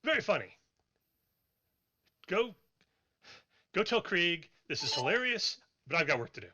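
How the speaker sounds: busy dwarf brushing off the visitors; sarcastic dwarf. Acted. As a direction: dismiss them as a joke and get back to work. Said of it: "He's like".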